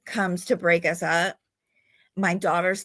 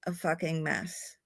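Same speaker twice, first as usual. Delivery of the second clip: a softer, firmer repeat; quiet emphatic repeat; 9 dB quieter than usual. Real, not acted. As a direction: drop the voice and lean on it. Repeats it for weight.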